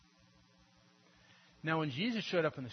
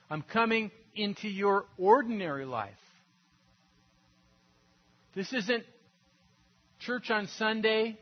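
louder, higher, driving Jesus' call home; emphatic preaching; 4 semitones higher, 5 dB louder than usual. Acted. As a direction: preach it out with full force.

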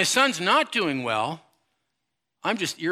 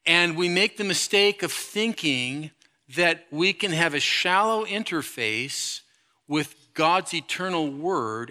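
ordinary talk, nothing marked

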